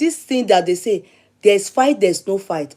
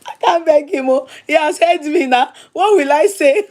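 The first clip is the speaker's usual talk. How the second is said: mock panic; 6 semitones higher, 3 dB louder than usual.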